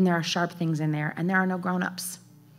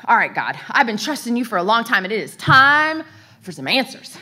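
louder, fed-up demand for answers; confronting God; 3 semitones higher, 6 dB louder than usual. Retold or imitated. Mother having it out with the Lord, loud confrontation. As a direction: have it out, pushing harder as it goes. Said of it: "I'm gonna have it out with the Lord".

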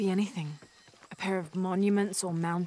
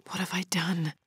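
guilty whisper to herself; hushed self-reproach. Acted. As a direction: breathe it out, no voice. Lara's guilty inner thought.

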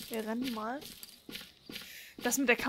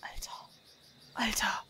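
breathy hushed whisper; whispering while hiding; 3 semitones lower, 9 dB quieter, slower than usual. Real, not acted. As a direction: whisper it so nobody hears. Whispers while monster near.

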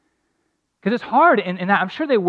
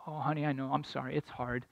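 tender comfort; 3 semitones lower, 13 dB quieter than usual. Retold or imitated. Father comforting crying daughters.

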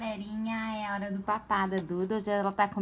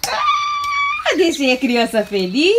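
happy shout; 9 semitones higher, 12 dB louder, much slower than usual. Real, not acted. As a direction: cheer it out loud. Mom cheers over happy child.

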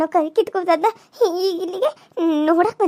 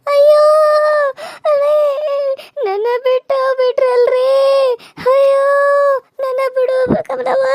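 wailing lament; 6 semitones higher than usual. Acted. Widow wailing over her husband.

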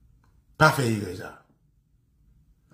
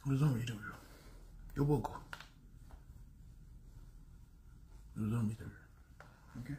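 hushed taunt; 5 semitones lower, 20 dB quieter, faster than usual. Real, not acted. Taunting, voice dropping away.

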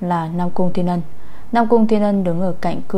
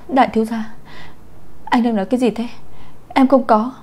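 desperate denial; 5 semitones higher, faster than usual. A narrator's reading. Bạch Ánh An desperate denial.

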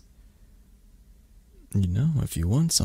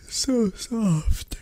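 high, airy sighed whisper; blissful sigh; 14 semitones higher than usual. Acted. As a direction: sigh it out slowly, melting into softness. Sinking into the listener's soft fur.

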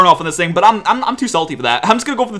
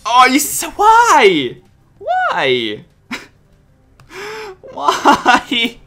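drawn-out cry of disbelief; dismayed wail; 6 semitones higher, much slower than usual. Real, not acted. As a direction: lament the bad luck, pitch swooping. Reacts to bad luck in game.

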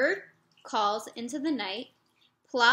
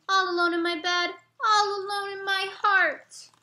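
put-on high, louder child's lament; a little boy crying out how alone he feels; lonely little boy; 8 semitones higher, 5 dB louder than usual. Jeremy alone in his room, high childish lament.

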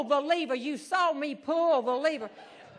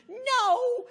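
shrill refusal; 11 semitones higher, 4 dB louder than usual. Retold or imitated. Norma refuses the guard.

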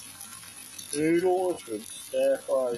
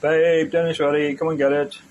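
calling out; 3 semitones higher, 9 dB louder than usual. Real, not acted. Calling partner to dinner.

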